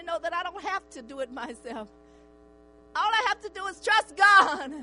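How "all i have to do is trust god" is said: preaching shout. Real, not acted. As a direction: drive the last words home to the church. Preaching at full force again.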